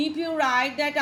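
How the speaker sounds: normal talking voice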